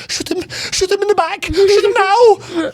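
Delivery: shrieky, very high mock outrage; hammed-up frantic command in a squeaky high voice; incensed manservant. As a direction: screech it, dragging out the last order. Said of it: "his manservant is so incensed".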